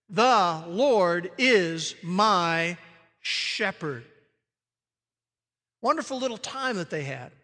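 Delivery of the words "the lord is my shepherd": very slow, each word like a finger counted. Shown as a demonstration. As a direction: count off "The Lord is my shepherd" one word at a time. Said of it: "That stands for thee".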